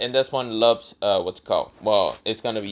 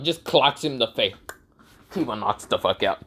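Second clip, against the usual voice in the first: ordinary delivery, nothing marked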